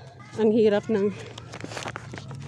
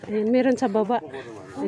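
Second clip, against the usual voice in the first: ordinary talk, nothing marked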